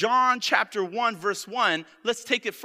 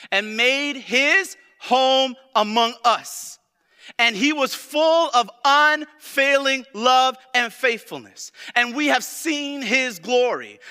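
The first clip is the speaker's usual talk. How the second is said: loud, high, drawn-out scripture proclamation; full-force preaching; 6 semitones higher, 9 dB louder, slower than usual. Real, not acted. Preacher proclaims John chapter one to congregation, forceful preaching. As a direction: hammer out the verse slowly and loudly.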